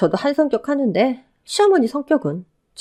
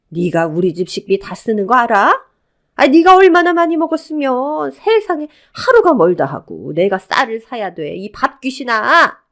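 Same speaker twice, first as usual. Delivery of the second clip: angry berating; 3 semitones higher, 6 dB louder than usual. Acted. Mother-in-law accusing her of eating up the budget, angry berating. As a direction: berate her, swelling loud and stretching the words. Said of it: "형님을 구박하기 시작했습니다".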